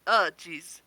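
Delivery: usual speaking voice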